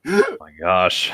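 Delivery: slow, drawn-out, low "oh my gosh"; stunned disbelief. Real, not acted. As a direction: let the absurdity sink in, slowly. Reacting to the absurd ending.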